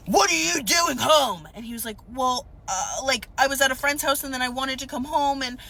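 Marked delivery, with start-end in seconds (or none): 0.1-1.5 higher, louder, slow mock yell at the kid; imitated mom yelling. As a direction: play the angry mom shouting at her son. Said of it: "who's screaming obscenities".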